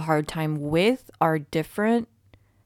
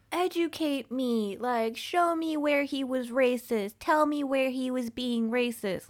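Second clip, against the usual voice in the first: higher, slow mocking imitation of a defensive celebrity; Sharon Osbourne; 7 semitones higher than usual. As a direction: parody her indignant demand, drawn out.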